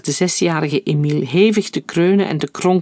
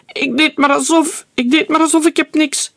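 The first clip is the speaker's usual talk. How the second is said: high, loud panicked wail; screaming in terror, begging; crying out; 11 semitones higher, 3 dB louder than usual. A narrator's reading. Terrified boy screaming and crying to his mother. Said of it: "huilde hij".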